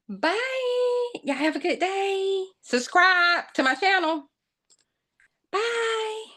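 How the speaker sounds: high, bright sign-off; sing-song goodbye. Real, not acted. Vlogger signing off to viewers, high sing-song goodbye.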